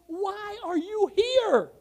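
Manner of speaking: high, slow, drawn-out demand; astonished question. Retold or imitated. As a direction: imitate an amazed onlooker, each word stretched. Retold amazed questioning of revival-goers.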